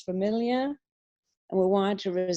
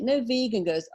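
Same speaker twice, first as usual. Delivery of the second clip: ordinary talk, nothing marked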